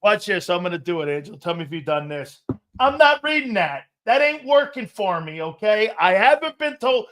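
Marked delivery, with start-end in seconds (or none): 2.8-7.0 louder, higher, balking at the script; mock indignant pushback at the producer; defiant refusal. Host refusing producer's script, mock indignant.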